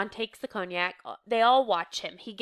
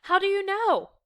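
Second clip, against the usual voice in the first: high, raised questioning call; calling over commotion; 11 semitones higher, 5 dB louder than usual. A narrator's reading. Reader voicing a woman above the excitement, calling out. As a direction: make yourself heard over the din. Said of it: "tries to make herself heard above the excitement".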